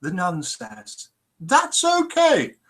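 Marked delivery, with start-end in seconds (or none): 1.4-2.5 drawn-out high nun's "okay"; slow high nun voice. Acted. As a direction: stretch it out, high and sweet, before the twist. Punchline nun voice.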